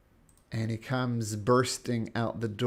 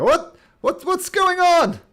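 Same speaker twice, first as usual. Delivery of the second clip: startled shout; 12 dB louder than usual. Acted. Guard dashes out alarmed.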